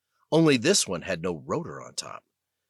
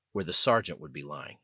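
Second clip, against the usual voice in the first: ordinary delivery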